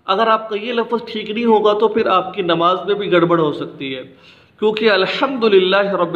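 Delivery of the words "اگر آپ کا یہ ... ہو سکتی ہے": ordinary talk, nothing marked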